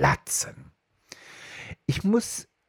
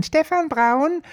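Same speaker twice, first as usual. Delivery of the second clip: put-on much higher, slow child's voice; 3 dB louder, much slower than usual. Boy answering the home phone, high child voice. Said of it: "so eine hohe Stimme als Kind".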